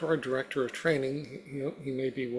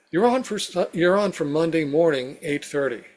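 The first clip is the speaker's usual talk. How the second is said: upbeat announcement; 4 semitones higher, 8 dB louder than usual. Retold or imitated. Quoted email, eager news.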